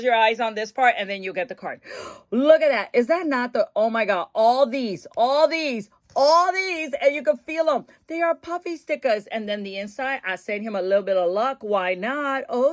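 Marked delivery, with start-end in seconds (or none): 4.3-8.8 excited. Real, not acted. Gushing over stickers.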